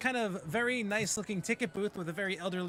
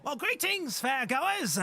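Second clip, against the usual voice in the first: put-on high, creaky old goblin greeting; elderly goblin; 7 semitones higher, 3 dB louder, slower than usual. Old goblin at the ticket booth greets the party, high and doddering.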